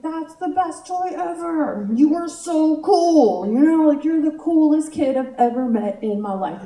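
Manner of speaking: hammed-up awe at a child's toy; gushing aunt. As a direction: hype up a little kid, laying it on thick. Aunt gushing over nephew's toy.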